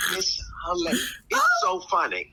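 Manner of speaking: high-pitched, helpless real laughter in the words; cracking up. Real, not acted. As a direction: squeeze the words out through laughter. Cracking up recalling the video.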